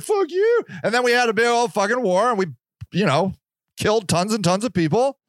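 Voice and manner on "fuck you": much higher, hammed-up king comeback; mock king retort. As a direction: fire it back as a petulant king. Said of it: "he's like".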